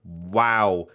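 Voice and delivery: usual speaking voice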